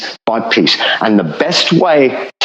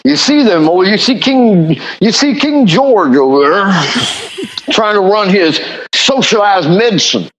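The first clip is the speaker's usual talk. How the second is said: mock American politician; 6 semitones higher than usual. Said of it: "American politicians pointing at it saying".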